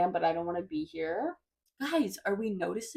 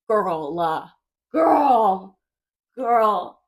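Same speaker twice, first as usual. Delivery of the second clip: exasperated cry; 2 semitones higher, 9 dB louder, much slower than usual. Real, not acted. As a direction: wail it in disbelief. Exasperated at old friend.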